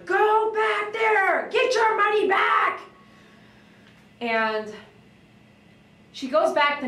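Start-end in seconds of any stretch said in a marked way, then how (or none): 0.0-2.8 high, slow yell at the owner; retold yell. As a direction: shout it high, dragging the first words. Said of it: "I'm yelling at the owner".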